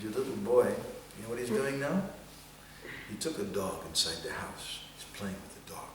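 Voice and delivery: dropping to a secret whisper; tattling whisper. Acted. Boy whispering to Sachi Mata about Nimai's dog. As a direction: hush it like telling on a friend.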